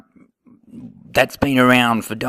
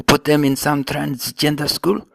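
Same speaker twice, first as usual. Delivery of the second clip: ordinary talk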